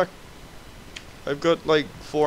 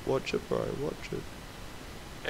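whispering; 10 semitones lower, 12 dB quieter, much slower than usual. Real, not acted. Warning near hidden enemy.

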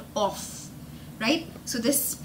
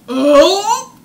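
high, loud fake burp; 7 semitones higher, 13 dB louder than usual. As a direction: let out a big burp. Burp sound acted.

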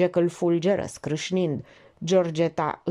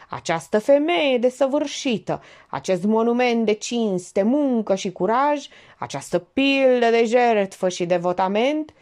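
raised, insistent oratorical praise; dogged pompous praise; 6 semitones higher, 4 dB louder than usual. A narrator's reading. The notary resumes his toast, dogged and grandiose. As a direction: press on stubbornly like a speech. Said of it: "notarul reluă îndârjit".

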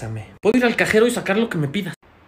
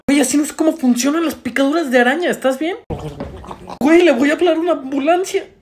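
high-pitched, loud disbelief; comic alarm; 9 semitones higher, 4 dB louder than usual. Acted. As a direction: panic loudly on a friend's behalf. Acted alarm about spider bite.

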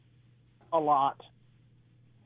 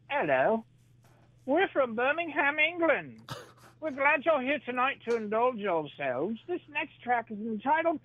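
much higher, hammed-up English accent; 10 semitones higher than usual. Napalm Death singer introducing a song, posh English accent. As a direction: play the polite English metal singer, English accent. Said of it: "he's like".

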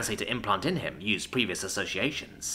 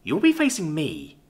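higher, slow, deliberate challenge; determined challenge; 6 semitones higher than usual. A narrator's reading. Alora challenges Isadora to face her, determined. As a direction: claim the fight for yourself. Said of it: "with a determined smile".

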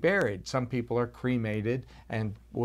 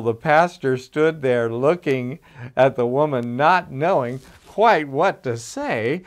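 usual speaking voice

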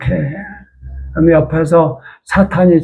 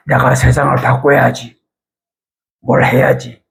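ordinary talk, nothing marked

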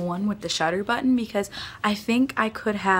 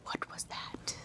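whispering; 18 dB quieter, slower than usual. Real, not acted. Whispered aside.

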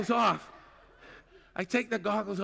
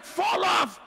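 alarmed; 6 semitones higher than usual. Retold or imitated. Alarmed at the warning.

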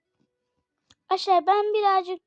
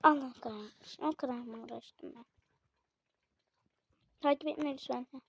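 ordinary talk, nothing marked

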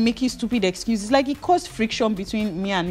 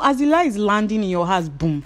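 ordinary talk, nothing marked

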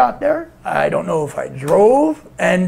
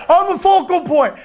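high, pushed mock holler; hammed-up high wail; catchphrase impression; 11 semitones higher than usual. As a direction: throw out the catchphrase in a strained wrestler voice. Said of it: "whenever you're".

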